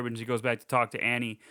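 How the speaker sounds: normal talking voice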